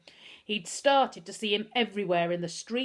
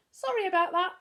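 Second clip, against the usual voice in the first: unapologetic; 13 semitones higher, 6 dB louder than usual. A narrator's reading. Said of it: "said Humphrey unapologetically".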